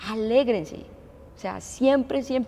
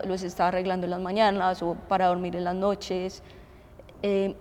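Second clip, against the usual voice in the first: ordinary talk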